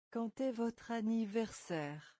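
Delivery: usual speaking voice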